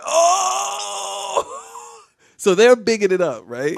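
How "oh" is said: high, drawn-out crowd "oh!". Retold or imitated. Storyteller imitates the whole lunchroom reacting, high crowd howl. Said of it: "everybody went".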